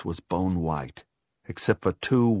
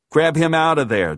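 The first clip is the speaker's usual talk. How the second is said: louder, higher command; urgent order; 6 semitones higher, 6 dB louder than usual. A narrator's reading. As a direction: call out a quick order. George orders the dog pulled away.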